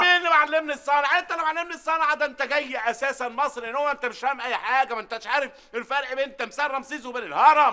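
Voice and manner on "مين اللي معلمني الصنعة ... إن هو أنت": indignant outburst. Acted. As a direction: throw the accusation back at him. Adel outraged at being taught.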